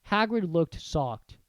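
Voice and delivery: ordinary delivery, nothing marked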